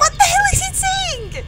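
high-pitched, incredulous outburst; baffled disbelief. Real, not acted. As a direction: react in startled confusion. Baffled by alarming game recording.

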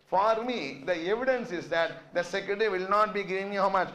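usual speaking voice

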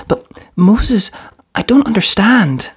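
put-on small boy who doesn't understand; confused child voice. A narrator's reading. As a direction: voice him young and bewildered. Young boy character confused.

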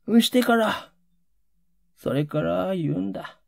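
plain reading voice

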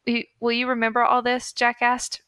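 usual speaking voice

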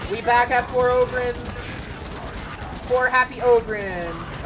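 loud, high, elated whoop; excited cheering. Real, not acted. Gamer hyped that the squad is four Ogryns, excited shout. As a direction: cheer it out at full volume. Celebrating a fourth Ogryn joining.

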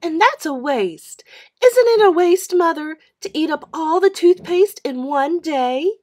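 high, loud appeal to Mother; a whiny kid wanting Mum on her side; indignant little girl, high and loud; tattling little sister. A narrator's reading. Mary voiced as a child.

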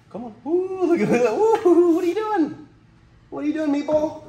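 high thrilled voice; excited squeal. Real, not acted. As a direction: squeal with delight. Snake suddenly active.

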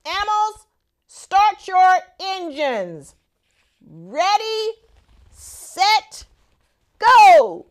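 high, drawn-out starter call building to a loud go; race announcer. Acted. Starter calling the animals to race, building loud.

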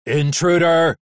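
loud machine alarm bellow. Acted. Scrapyard machine bellowing at the intruder, loud. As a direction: boom it out like a siren. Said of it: "it bellowed".

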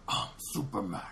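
low, put-on giant robot voice; deep robot voice. Robot picks Superman instead, in an imitated deep voice. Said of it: "he has, like, a deep voice".

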